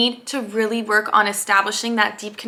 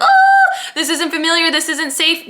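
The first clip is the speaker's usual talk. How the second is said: higher, louder alarmed ego with a laugh; mock scared ego voice; 5 semitones higher, 3 dB louder than usual. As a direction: play the nervous inner voice, half laughing. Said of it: "subconscious mind will be like".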